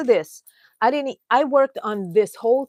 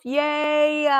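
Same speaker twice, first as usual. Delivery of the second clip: cheering; 7 semitones higher than usual. Real, not acted. Outfit stays on doll.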